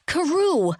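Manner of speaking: loud urgent shout. A narrator's reading. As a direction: yell the name out. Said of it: "I shouted at him".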